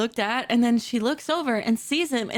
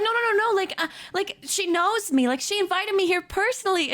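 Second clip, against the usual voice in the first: high, insistent objection; higher-pitched, stumbling insistence; flustered protest; 6 semitones higher than usual. Retold or imitated. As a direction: protest hurriedly in a raised pitch. Acted protest to the bartender.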